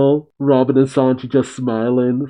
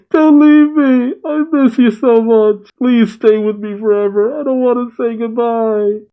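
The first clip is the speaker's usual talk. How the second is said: crying; 9 semitones higher than usual. A narrator's reading. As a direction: beg like a bawling child, voice pitched way up. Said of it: "he busts out crying".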